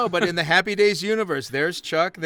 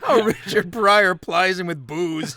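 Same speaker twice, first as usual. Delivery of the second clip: ordinary talk, nothing marked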